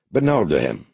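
usual speaking voice

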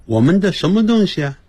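ordinary talk

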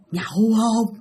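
eerie altered voice, drawn-out question; ghostly invitation. Retold or imitated. Ghost leaning over dad asking, eerie invitation. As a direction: ask it like a ghost face to face. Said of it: "Đó, hỏi ba vậy đó".

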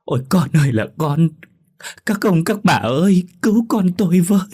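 keening plea of a grieving mother; loud wailing cry. A narrator's reading. Mother begging the neighbours for help, loud high wail. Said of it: "tủi thân khóc lớn".

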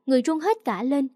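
plain reading voice

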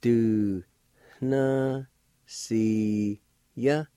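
very slow, long held syllables; teacher sounding a word out slowly. Shown as a demonstration. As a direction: stretch each syllable out with long pauses between. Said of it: "it's pronounced like this".

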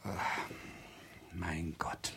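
under his breath; whispered. Acted. Professor whispering in dismay at bad news.

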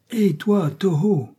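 high call across to Toho. Acted. Bangkok calls out to Toho, high hailing call. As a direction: hail him from a distance.

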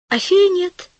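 plain reading voice

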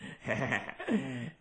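Pigsy laughing helplessly; roaring laugh. Acted. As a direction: collapse into laughter. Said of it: "cười rũ rượi".

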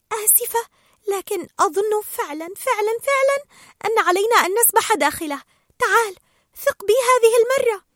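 high, insistent character voice; Dory, earnest pleading. Acted. Dory asking Marlin to trust her, high and pleading.

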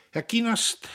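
high, loud, effortful address; forced out. A narrator's reading. Brother gives in to sister's plea, forcing words out. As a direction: squeeze the name out reluctantly. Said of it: "brachte er hervor".

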